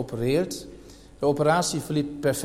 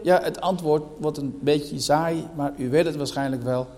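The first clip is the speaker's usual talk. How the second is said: normal talking voice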